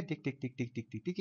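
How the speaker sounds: soft, steady spoken ticks; imitated timer ticking. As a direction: tick away quietly like a stopwatch. Imitates timer at minute seventeen.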